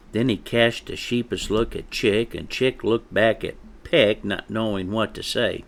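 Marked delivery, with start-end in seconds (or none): none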